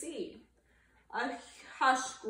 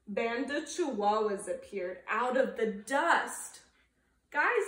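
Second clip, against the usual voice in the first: ordinary delivery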